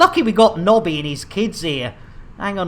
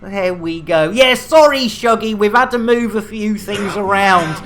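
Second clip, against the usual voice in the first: normal talking voice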